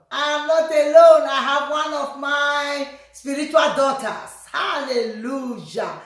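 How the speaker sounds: ordinary delivery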